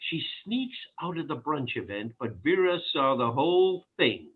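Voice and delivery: ordinary talk, nothing marked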